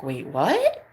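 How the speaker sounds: stunned disbelief; bewildered. Retold or imitated. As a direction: caught off guard by an empty chat. Realising everyone left.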